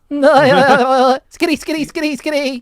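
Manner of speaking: high, loud cartoon skitty chant; SpongeBob-like skitty. Showing the chant sounds like SpongeBob laughing, high and loud. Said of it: "sounds like SpongeBob's laugh".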